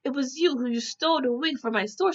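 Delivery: a hint of an outraged store owner. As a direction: point the finger like a shop owner.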